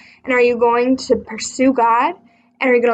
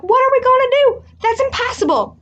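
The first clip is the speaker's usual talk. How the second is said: high, loud, panicked cry; panicked disciples; 12 semitones higher, 3 dB louder than usual. Acted. Disciples panicking before Jesus, high and loud. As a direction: wail it like scared followers. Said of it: "the disciples were like".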